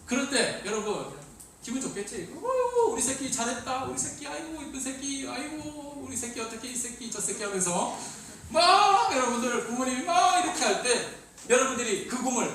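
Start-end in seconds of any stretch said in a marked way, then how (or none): 2.3-7.4 doting parent. Retold or imitated. Parents dote over top grades.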